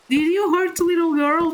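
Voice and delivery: high, worried grandma's question; alarmed grandma. Retold or imitated. Grandma asking mom about the crying child, high and worried. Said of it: "my grandma ... was like".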